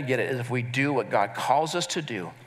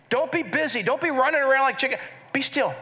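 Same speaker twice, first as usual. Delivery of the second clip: higher, lively urging; animated preaching; 10 semitones higher than usual. Real, not acted. Pastor urging busy listeners, animated and lively. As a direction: push them to stop rushing. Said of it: "preaching emphasis".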